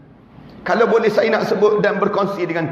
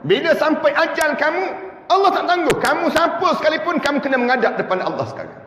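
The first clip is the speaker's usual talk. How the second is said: fervent preaching; 6 semitones higher than usual. Real, not acted. Preaching at full force.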